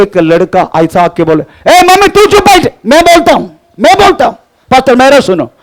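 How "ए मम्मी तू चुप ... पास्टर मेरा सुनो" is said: put-on bossy boy. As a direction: push past the mother to talk to the pastor. Boy tells mother to sit quiet. (ऊँची, तेज़ आवाज़ में घमंडी लड़का)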